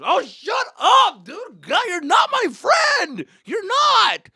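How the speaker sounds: put-on high, dragged-out teenage screaming; acted furious yell. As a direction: explode at mom, loud and high. Acted teen yelling at mother.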